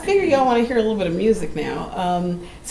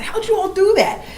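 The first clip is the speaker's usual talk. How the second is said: amazed question; 13 semitones higher, 3 dB louder than usual. Retold or imitated. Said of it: "I said".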